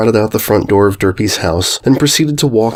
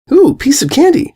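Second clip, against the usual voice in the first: delighted ooh. Acted. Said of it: "she said".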